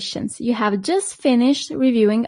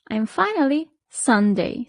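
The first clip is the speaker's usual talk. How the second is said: usual speaking voice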